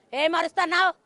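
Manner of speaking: loud, high mock scolding; mimicking the landlord's harsh shout; imitating a scolding. Widow imitating the house owner scolding her, loud. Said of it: "ఇల్లు గల్లోడు అంతాండు".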